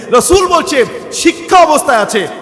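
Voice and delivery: high, loud fiery sermon; preaching at full force. Real, not acted. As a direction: preach it with rising fervour to the crowd. (জোরালো উচ্চস্বরে ওয়াজ)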